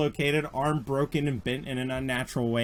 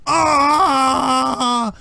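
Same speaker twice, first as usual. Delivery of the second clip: long, high, loud scream; acted pain scream; 13 semitones higher, 7 dB louder than usual. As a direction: scream as if your arm is wrenched out. Arm ripped out of socket.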